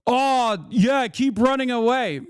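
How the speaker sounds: high-pitched taunting call; imitated yelling from afar. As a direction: mockingly imitate a parting jeer. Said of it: "to yell from afar".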